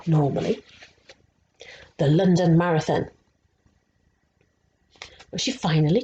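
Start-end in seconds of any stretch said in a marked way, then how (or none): none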